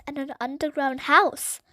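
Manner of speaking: ordinary delivery, nothing marked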